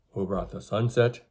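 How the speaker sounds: ordinary delivery, nothing marked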